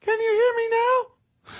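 very high, slow, thin squeak. Shown as a demonstration. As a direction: pitch it way up and thin it out. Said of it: "asked in a high, thin voice".

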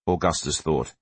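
usual speaking voice

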